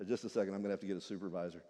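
low, fast, soft brush-off; clerk angel. Acted. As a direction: hurry off to fetch help. Angel calls supervisor.

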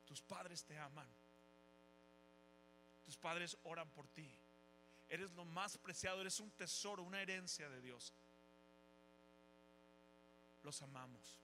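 tender blessing. Acted. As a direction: speak it gently like a blessing. Tender blessing over students, music.

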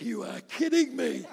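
high, slow, laughing disbelief. Retold or imitated. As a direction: stretch it out, half laughing, can't believe it. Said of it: "I'm like".

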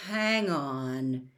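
low, soft, dawning realization; slowly. A narrator's reading. Harry halting halfway down the corridor, slow and low. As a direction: draw it out low and soft as it dawns. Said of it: "said Harry slowly".

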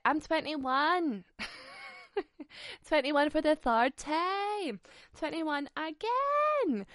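mock cutesy denial.

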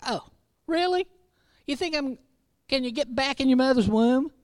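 high, sarcastic mock disbelief; mocking smart aleck retort. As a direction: sneer it like a smarty pants. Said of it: "the tone of that ... a smart aleck".

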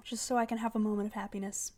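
ordinary talk

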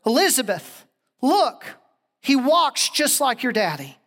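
mildly excited call, pitch jumping on the first words; startled recognition. Retold or imitated. Grandmother calls to Elizabeth on seeing the man, startled recognition. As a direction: call out in dawning, amazed recognition.

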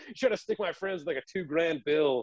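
ordinary talk

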